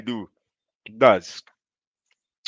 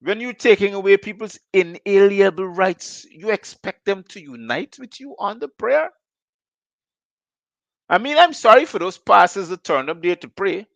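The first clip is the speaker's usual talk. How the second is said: indignant rant; 8 semitones higher, 5 dB louder than usual. Real, not acted. Host's heated rant against the regime.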